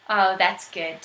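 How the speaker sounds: usual speaking voice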